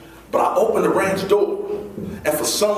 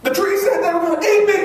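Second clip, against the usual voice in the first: put-on panicked kid. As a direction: blurt it high and frightened. Panicked boy tells director.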